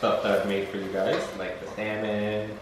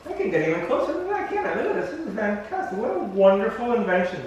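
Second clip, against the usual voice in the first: excited; 10 semitones higher than usual. Real, not acted. Delighted by camera closeup.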